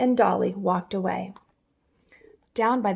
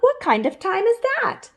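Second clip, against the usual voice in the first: high-pitched, perplexed; squeaky chipmunk; 13 semitones higher, 4 dB louder than usual. Acted. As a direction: squeak out your confusion. Chipmunk confused.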